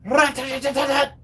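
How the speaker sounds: high staccato machine gun rattle, voiced; gunfire noise. Gamer imitating enemy gunfire heard over the map, rattling high. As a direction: spit out a rapid gun burst sound. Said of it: "I heard".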